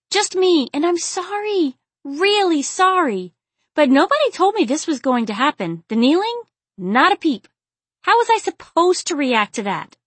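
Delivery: high-pitched, agitated pleading; pleading heroine, tipsy. A narrator's reading. Aziza breaking under Brandon's stare, pleading and flustered. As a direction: blurt out an apology and protest in a high voice.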